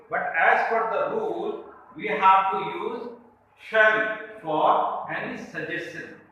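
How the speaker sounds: ordinary delivery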